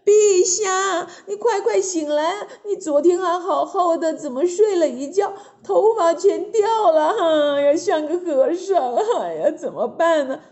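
high-pitched frantic wail; distressed queen, high and wailing; urgent calling out. A narrator's reading. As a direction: rouse the king in a panic. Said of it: "急忙叫起来了".

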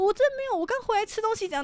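high squeaky grandma protest; imitating grandma. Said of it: "然后我奶奶就说". (高声学奶奶争辩)

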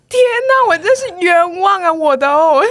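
high, loud cry of injustice; wronged outcry. Retold or imitated. Teacher realising she was falsely blamed: loud high outcry. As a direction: wail it like a wrongly accused hero. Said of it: "我就想说".

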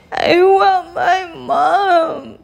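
high, drawn-out mock wail; hammed-up tearful whine; storybook crying. A narrator's reading. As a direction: wail it slowly like a hurt little princess. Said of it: "cried the little princess".

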